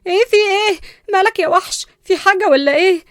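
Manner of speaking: loud, high-pitched panic; jolted awake, shrill shouted questions; startled cry. Acted. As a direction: yelp in alarm at being grabbed. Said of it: "بس صرخت بخضة".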